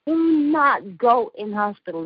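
"not" hit hard, each word slowed; slow emphatic warning. Real, not acted. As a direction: spell it out slowly, stressing "not". Urgent warning, repeated slower.

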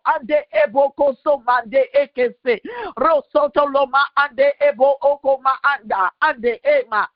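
ordinary delivery

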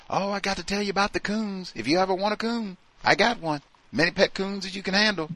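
high, fast chirpy offer; mock eager coworker. Coworker eagerly offering pet coons, high and fast. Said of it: "if they were coming to you".

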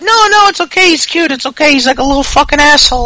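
high girly mock Carmella voice. Carmella excusing Ellsworth to the fans, in a high mock girly voice. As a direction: pitch way up, breezy and dismissive like Carmella. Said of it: "And Carmella's like".

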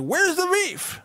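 put-on high squeaky old lady. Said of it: "that old lady's like".

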